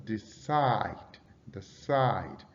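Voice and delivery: "decide" slowly with CIDE drawn out; short "de", long held CIDE in "decide"; stress on second syllable. Shown as a demonstration. Teacher demonstrating verb stress, stretched CIDE in "decide". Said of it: "stress the second syllable".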